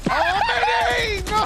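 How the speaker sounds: high, loud stretched cry; excited shout. Real, not acted. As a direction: yell out as the player goes down. Caster reacts to player dying.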